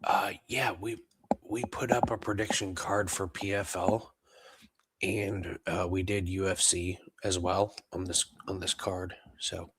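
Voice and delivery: hushed whisper so as not to wake anyone. Real, not acted. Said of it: "I know this is strange that I'm whispering".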